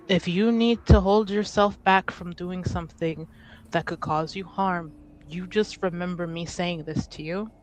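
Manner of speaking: ordinary delivery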